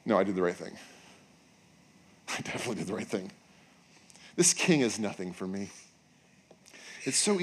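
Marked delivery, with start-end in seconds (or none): 0.1-5.7 low, defiant self-assurance; dropping low to sound sure of himself; Judas, resolved. Pretend. Judas convincing himself he chose right, low and defiant.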